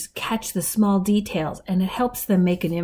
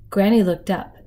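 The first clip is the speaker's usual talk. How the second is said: plain reading voice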